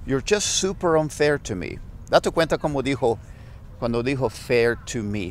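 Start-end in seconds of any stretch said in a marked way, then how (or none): 4.3-5.3 fair to me, stretched and slow; slow it down to show the fast bit; slowed-down phrase. Shown as a demonstration. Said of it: "Lo dijo tan rápido". (despacio para mostrar lo que dijo rápido)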